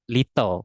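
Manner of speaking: clearly sounded T in little; the T left in little; little with full T. Shown as a demonstration. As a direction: say "lit-tol", T and all. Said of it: "Jadi bacanya bukan".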